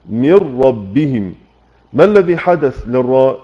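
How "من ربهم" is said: من ربهم slowed, the نون merged into a doubled راء; idgham demo. Shown as a demonstration. Teacher slowly demonstrating merging in من ربهم. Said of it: "في الإدغام قلنا إيه".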